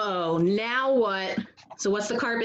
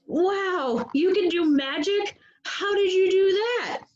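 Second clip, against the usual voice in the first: higher, wide-eyed amazement; amazed; 7 semitones higher than usual. Acted. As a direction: gasp at the magic trick. Doug amazed at magic.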